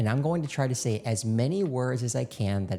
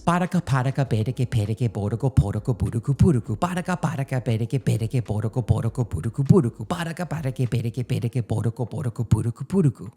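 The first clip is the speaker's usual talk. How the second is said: louder rapid-fire syllables in one breath; one-breath rapid drill; 5 dB louder, much faster than usual. Shown as a demonstration. Coach drives the three-round drill, louder and nonstop. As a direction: push through all three rounds without a pause. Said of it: "say it three straight times, all in one breath".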